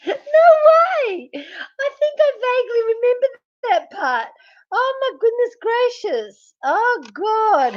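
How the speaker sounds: high, breaking laughter through the words; crying with laughter; squealing with laughter, barely getting words out; laughing fit. Real, not acted.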